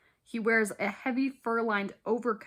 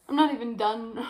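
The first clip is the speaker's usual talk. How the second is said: worn-out complaint, a bit louder; exasperated; 4 dB louder, faster than usual. Real, not acted. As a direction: groan it out, fed up. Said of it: "I'm exhausted".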